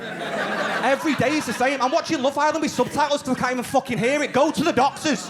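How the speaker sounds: rant, completely fed up; exasperated rant. Retold or imitated. Continuing girlfriend's irritated rant.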